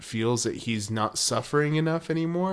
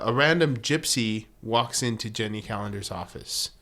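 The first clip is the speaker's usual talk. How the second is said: ordinary talk, nothing marked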